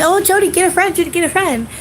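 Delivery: high, loud, sing-song nagging; mocking everybody's advice. Mocking the people who told her to get a friend, high and nagging. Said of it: "Everybody told me".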